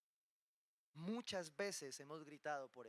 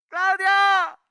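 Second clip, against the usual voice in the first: huge high-pitched holler to a neighbour; calling out; 13 semitones higher, 25 dB louder than usual. Acted. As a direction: yell a name across to another house. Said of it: "Su tono".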